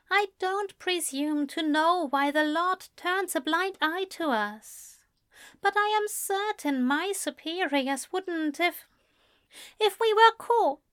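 high, earnest pleading; pleading angel. A narrator's reading. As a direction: plead with him. Aziraphale worries.

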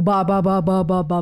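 low, monotone chant of ba-ba-ba; droning sleep-talk syllables, pitched well down; repeated "ba" syllables. Shown as a demonstration. Woman showing how she wakes herself from nightmares, low chanting. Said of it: "Então eu fico verbalizando a sílaba".

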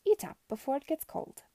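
Madam presses stew on her captive, overly patient; exaggerated patience. A narrator's reading. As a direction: coax her like a tired nanny. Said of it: "she said with exaggerated patience".

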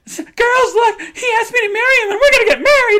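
very high, loud falsetto squeal, near crying; gushing announcement. Retold or imitated. Laura telling teenage girls she's engaged, falsetto squeal. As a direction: squeal the news to strangers.

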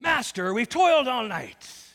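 loud, hammy sea-dog bellow, drawn out; exaggerated fisherman complaint. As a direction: overplay it as a pirate-like fisherman. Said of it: "No, he doesn't say it like that".

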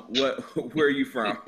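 normal talking voice

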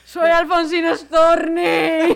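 slow, high, loud hammed-up poet declaration; tragic poet cry. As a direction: wail it like a doomed poet. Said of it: "Un poeta maldito ahí".

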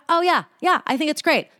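high-pitched, falsely agreeable; fake approval. Replying to someone being shitty, fake cheery approval. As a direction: gush insincere approval in a high voice.

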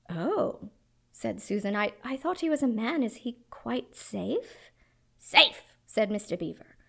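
lively read-aloud with voiced characters; story reading. Preacher reading Narnia passage to congregation, dramatic. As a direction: read it like a bedtime story, big and hushed by turns.

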